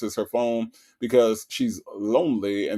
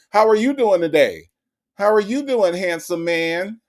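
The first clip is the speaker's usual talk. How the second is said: flirty mock Sonya; 6 semitones higher than usual. Host mocking Sonya flirting with a scammer, high sugary coo. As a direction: coo at the caller like a lonely flirt. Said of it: "This is how she talked to the scammers".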